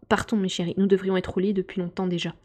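ordinary delivery, nothing marked

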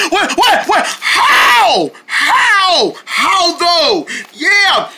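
high-pitched alarmed voice; shocked high squeal. Acted. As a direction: squeal in disbelief. Deerlings find bugs inside.

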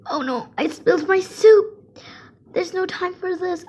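high, loud crying wail. Acted. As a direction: wail over the spilled soup. Spilled the soup.

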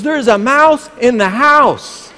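loud, high, drawn-out panicked yell; imitated screaming. Husband mimics wife reporting a mouse, screaming. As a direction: holler it slowly, mock alarm. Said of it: "I heard her scream".

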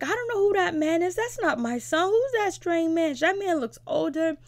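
high, loud mimicked wail; mock crying. Tattoo client insists that is not her son, mock crying. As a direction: imitate a distraught mother protesting. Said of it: "And she's crying".

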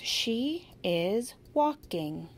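"She is walking" very slow, stressing She; slow modeling. Shown as a demonstration. Teacher modeling each word for the child, slow and clear. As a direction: lay out every word slowly and clearly. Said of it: "make sure they're getting all those parts".

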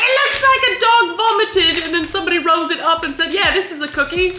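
high, loud rant of disgust; disgusted outburst. Real, not acted. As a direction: shout it out in exaggerated disgust. Disgusted outburst about the cookie.

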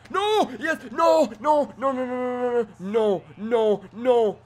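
loud, high string of noes; panicked shouting. Real, not acted. Gamer yelling no as the opponent powers up, panicked.

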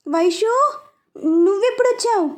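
sister's alarmed question on finding her sibling in the car; flustered. A narrator's reading. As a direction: caught off guard, flustered. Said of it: "అని అడిగింది కంగారుగా".

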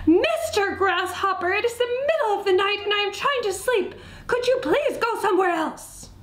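high, exasperated mouse voice; upset pleading. Acted. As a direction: plead for sleep in a high, distressed voice. Sleepless tearful mouse pleads with grasshopper.

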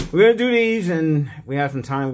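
loud and high, hyped up; excited. Real, not acted. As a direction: sell the excitement. Hyped start of ticket batch.

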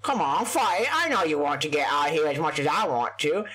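usual speaking voice

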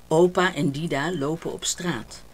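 ordinary delivery

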